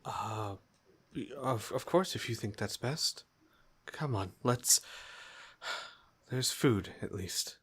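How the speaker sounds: soft, quiet, sighing reply; mother too tired to argue, quietly agreeing; tired mom. Acted. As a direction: give in gently, worn out.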